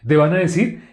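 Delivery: ordinary talk, nothing marked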